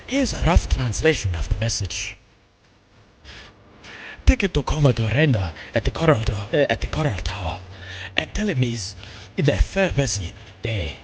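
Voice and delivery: lightly put-on English accent; English accent reading a message. Streamer reading the translated message in an English accent. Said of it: "that's why I was doing an English accent".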